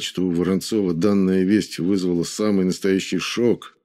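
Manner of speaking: plain reading voice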